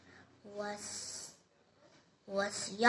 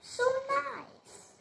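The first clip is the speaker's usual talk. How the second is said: high, loud squeal of delight with a laugh; Rapunzel delighted; 12 semitones higher, 5 dB louder, much slower than usual. Acted. Rapunzel finds the gold, squealing high with laughing delight. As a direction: gasp over the gold like a thrilled little girl. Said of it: "And she said like this".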